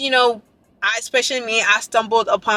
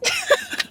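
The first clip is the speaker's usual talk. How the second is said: very high embarrassed laugh; real squealing giggle; flustered squeal; 6 dB quieter than usual. Real, not acted. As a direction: squeal in disbelief. Flustered by compliment.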